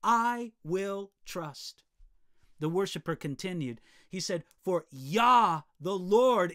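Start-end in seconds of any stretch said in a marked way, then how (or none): 0.0-1.8 very slow, each word set down firmly; slow proclamation. Shown as a demonstration. Preacher showing the congregation how to proclaim, very slowly. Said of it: "simply proclaim". 4.6-6.5 much louder, ringing out; loud praise. Acted. Preacher voicing the worshiper's praise, loud and ringing.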